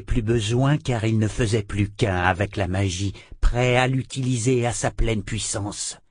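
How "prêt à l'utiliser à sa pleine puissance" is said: plain reading voice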